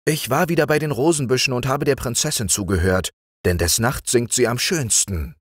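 high, quick eager frog voice; the green frog rattling off his excuse. Acted. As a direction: hurry through the excuse.

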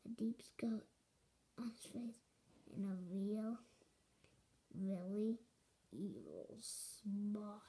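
hushed, spooky storytelling whisper; low creepy whisper. Acted. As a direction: lean in and whisper it like a scary secret.

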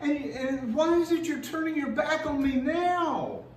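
a son begging his father not to turn away; pleading son. Retold or imitated. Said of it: "It's like he's saying".

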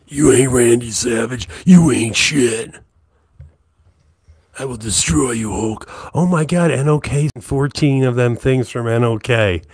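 loud gruff wrestler promo yell; Randy Savage. Acted. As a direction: cut a furious wrestling promo. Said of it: "a little Randy Savage going on in there".

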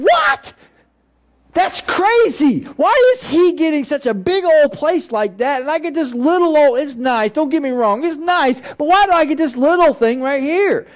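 high, indignant complaint about a small house; outraged pastor whining to Saint Peter, pitched high; dramatic pastor. Acted. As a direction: ham up a preacher protesting at heaven's gates. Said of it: "He's being really dramatic".

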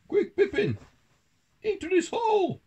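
very high, loud hurried warning; urgent mouse squeak. A narrator's reading. Town mouse steering his friend from the cat, urgent squeak. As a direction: squeal orders to your friend.

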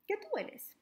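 put-on high, bright question to children; asking the kids. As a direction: lift the voice way up to ask the little ones.

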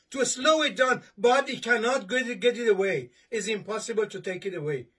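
normal talking voice

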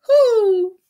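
high, loud owl hoot; a loud high hoo. A narrator's reading. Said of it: "he just hooted like an owl".

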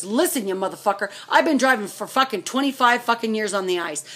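ordinary talk, nothing marked

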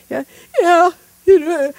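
high, loud crying yes; sobbing kid. Retold or imitated. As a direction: let the boy's tears break through. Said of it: "I can see he's crying".

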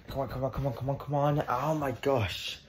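low, soft, pleading mutter; frustrated muttering. Acted. Alone at the car, it won't open, frustrated muttering. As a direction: coax a stuck flap under your breath. Struggling alone with a stuck fuel flap.

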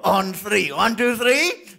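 usual speaking voice

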